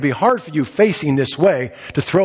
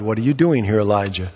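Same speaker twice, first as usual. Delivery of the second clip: a lightly voiced God, low and grave; hint of a solemn divine voice; 8 semitones lower than usual. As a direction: let God ask it low and searching. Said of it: "the words came to Elijah that day by God".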